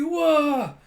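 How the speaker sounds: high, loud cartoon wah as the kaiju swarm; a big dramatic wah!; loud mock wail. Narrator reacts to a kaiju swarm with a loud wah.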